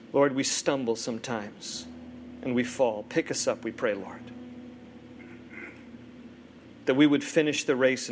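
low, unhurried prayer with long pauses; pastoral prayer. Real, not acted. Pastor praying for the weary, low reverent prayer. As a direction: pray earnestly, phrase by phrase.

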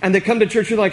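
normal talking voice

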